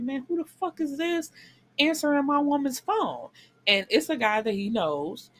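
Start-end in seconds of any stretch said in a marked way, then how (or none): 0.0-3.3 high-pitched acted outrage, not shouted; indignant demand. Luca to man answering his woman's phone, acted indignant. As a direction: confront a stranger in a raised pitch.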